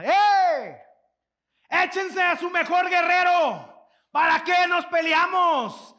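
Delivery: high, booming taunts across the battlefield; Goliath's shout. Retold or imitated. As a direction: roar the challenge at the whole army. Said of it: "todos los días les gritaba".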